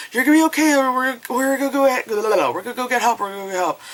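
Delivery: high, hurried babble; mock panicked reassurance. Rescuers promising the girl help, mock panicked and high. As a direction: imitate frantic rescuers tripping over their words.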